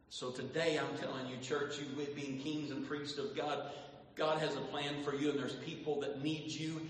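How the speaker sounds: usual speaking voice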